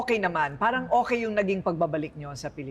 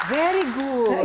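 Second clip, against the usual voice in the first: a light hint of a teacher's high, loud praise; 6 semitones higher, 4 dB louder, slower than usual. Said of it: "Sabi ng teacher". (parang gurong pumupuri)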